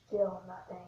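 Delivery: unvoiced whisper. Real, not acted. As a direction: breathe it out without voice. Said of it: "whispering while trying to sneeze".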